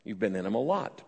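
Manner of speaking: ordinary talk, nothing marked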